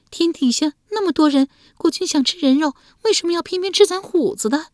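put-on young wife voice. Wife demanding why her husband would kill their son, indignant. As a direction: press him with indignant questions. Wife's voice.

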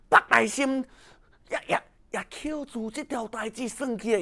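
usual speaking voice

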